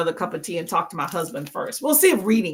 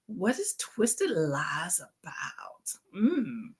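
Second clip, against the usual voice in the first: whisper; 3 semitones lower, 8 dB quieter, much slower than usual. Real, not acted. Reading chat aside.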